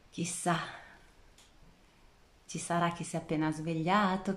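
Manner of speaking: ordinary talk, nothing marked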